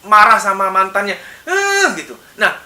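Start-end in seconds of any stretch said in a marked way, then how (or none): 1.5-1.9 much higher angry heh; mock angry shout. Shown as a demonstration. Psychologist demonstrating the third pent-up yell at the ex. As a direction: yell the anger at the ex. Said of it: "Teriakan tiga yang harusnya dia marah sama mantannya".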